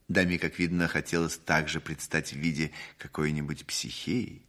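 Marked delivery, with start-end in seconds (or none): none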